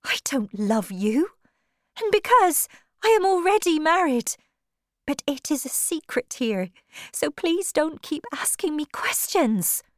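high-pitched, pleading woman's voice; distressed Tess. Acted. Woman confessing a secret marriage to her suitor, distressed.

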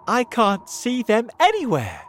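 high, loud exclamation; relieved cry. A narrator's reading. James finds cloud men gone, excited cry. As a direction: call back the good news. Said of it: "he cried".

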